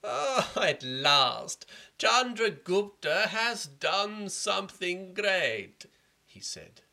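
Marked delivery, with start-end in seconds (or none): 0.0-5.9 very slow, higher sneering drawl; scheming minister mocking the hero; sarcastic minister. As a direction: drip with sarcasm. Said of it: "the rogue minister was very sarcastic".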